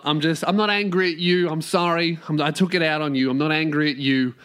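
ordinary talk

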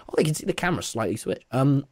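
ordinary talk, nothing marked

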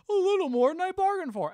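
high, loud cartoon villain; Dick Dastardly. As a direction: imitate a villain caught off guard, voice way up. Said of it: "Dick Dastardly just goes".

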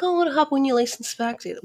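a high, hammed-up advice-giver's voice; mocking the advice. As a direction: ham up the sweet, know-it-all advice. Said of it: "It's like".

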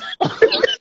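breathless laugh. Real, not acted. Laughing at his own story.

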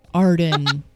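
ordinary talk

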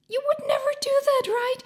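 high, fast, breathy disbelief; can you imagine doing that; incredulous whisper. Real, not acted. Incredulous rhetorical aside.

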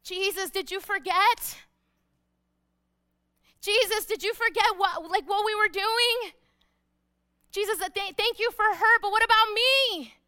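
high, loud protest; aggrieved pleading. Acted. Forgotten father appeals to Jesus, loudly aggrieved. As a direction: plead indignantly, pushing harder toward the end.

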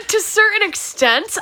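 high, loud mocking echo; imitating her. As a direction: parrot her words back, teasing. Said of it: "You said".

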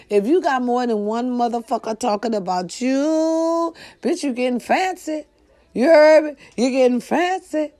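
loud, high sassy callout; sassy hype. Real, not acted. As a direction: hype them up with attitude.

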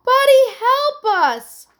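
high, loud plea; desperate yell. A narrator's reading. Girls to Buddy from the boat, yelling. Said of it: "yelled the girls from the boat".

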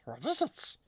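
high, hissing creature voice; Gollum impression. Mimicking the characters as Gollum, creepy creature voice. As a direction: croon it like a greedy creature. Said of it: "they're like".

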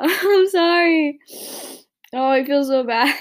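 high, loud wail of real embarrassment; tearful apology. Real, not acted. Podcaster apologizing to listeners for crying, high tearful wail. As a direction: apologize loudly, on the edge of crying. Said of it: "I don't wanna cry".

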